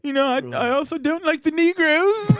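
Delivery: high, smug put-on voice; mock J K Rowling. Friends mocking the author, high smug voice. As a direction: play the author as a sneering bigot.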